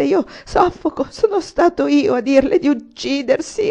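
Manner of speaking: high, loud, choking outburst; frantic remorse and horror. A narrator's reading. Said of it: "soffocato da un impeto di rimorso".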